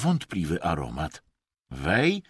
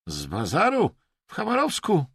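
two questions climbing high; ominous echo; 10 semitones higher, 3 dB louder than usual. A narrator's reading. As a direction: repeat it with foreboding. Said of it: "powtórzył złowieszczo Wej". (złowieszcze powtórzenie)

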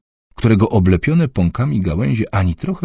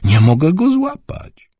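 high, slow fretting wail; 12 semitones higher, slower than usual. Acted. Mother frets about son.

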